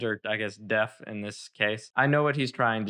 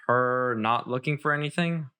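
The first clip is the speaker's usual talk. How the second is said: ordinary talk, nothing marked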